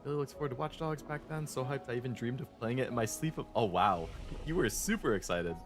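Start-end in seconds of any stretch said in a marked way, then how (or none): none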